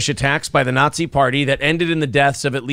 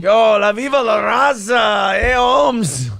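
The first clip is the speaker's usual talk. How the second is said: put-on hardcore Mexican accent; 6 semitones higher, slower than usual. Host imitating the caller's hardcore Mexican accent. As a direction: shout it like a cartoon Mexican tough guy. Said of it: "hardcore Mexican accent".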